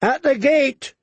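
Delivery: high alarm cry; shouting. A narrator's reading. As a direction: shout a warning from along the wall. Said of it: "a shout came".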